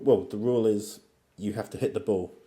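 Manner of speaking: normal talking voice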